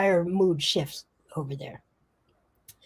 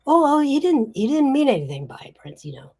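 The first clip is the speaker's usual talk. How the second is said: high, hurried smoothing-over; higher, rushed appeasing wiseguy; placating gangster; 9 semitones higher, 5 dB louder than usual. Acted. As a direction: jump in quickly to calm a touchy boss. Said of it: "The other guy says".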